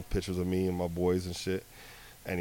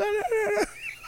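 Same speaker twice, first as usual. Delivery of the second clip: laugh. Real, not acted. Laughing at own impression.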